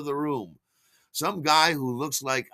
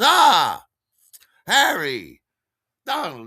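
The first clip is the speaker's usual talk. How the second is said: hearty greeting; 6 semitones higher, 9 dB louder, slower than usual. Retold or imitated. Steve greets, introducing new guy.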